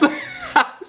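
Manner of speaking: sudden squeal of a laugh; high burst of laughter. Real, not acted. Vlogger bursting out laughing at a Phantom singer.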